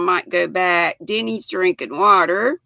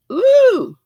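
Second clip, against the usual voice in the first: surprised ooh; 6 dB louder than usual. Real, not acted. Scrolling far back through comments.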